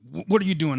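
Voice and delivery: a harsh, loud, suspicious what are you doing; sharp interrogating. Shown as a demonstration. Father demonstrating the wrong, harsh curiosity. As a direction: snap the question like an accusation. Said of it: "Not like that kind of curiosity".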